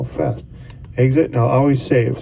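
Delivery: usual speaking voice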